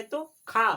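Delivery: का said loud with a long full vowel; a strong, loud का; forceful long aa. Shown as a demonstration. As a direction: punch का out with power. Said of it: "उसको थोड़ा power से बोलोगे".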